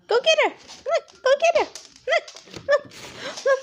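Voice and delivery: high, eager call to a pet; talking to a dog. Real, not acted. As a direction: coax the dog to chase. Urging dog to chase bubbles.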